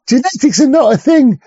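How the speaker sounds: high, sneering mock pronouncement; mock earnest presenter. Vlogger mimics a documentary host, high mocking tone. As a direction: parody a lecturer, pitched high.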